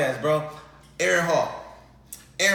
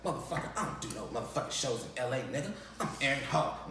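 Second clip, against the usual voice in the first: low, quick dismissive snarl; lightly voiced arrogant star; arrogant celebrity; 4 semitones lower, 11 dB quieter, faster than usual. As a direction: put the kid in his place. Celebrity snaps, retold.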